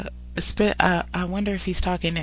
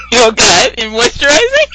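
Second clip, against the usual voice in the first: laughing shout; 12 semitones higher, 13 dB louder than usual. Real, not acted. Teasing cohost about beard care.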